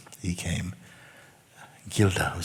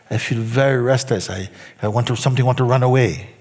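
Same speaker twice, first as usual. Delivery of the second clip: louder, higher mock restless complaint; imitating restless plea; 5 semitones higher, 6 dB louder than usual. Student admits to the teacher he wants to run, mock restless. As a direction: play the squirming student wanting out.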